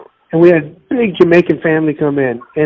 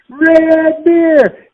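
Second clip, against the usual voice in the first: excited; 12 semitones higher than usual. Real, not acted. Excited exclamation about Red Stripe.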